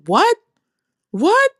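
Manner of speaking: put-on high, fast, indignant old mother; mother complaining. The mother scolding them for not listening, high and indignant. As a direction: play her mom protesting she's ignored.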